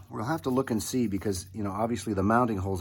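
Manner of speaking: usual speaking voice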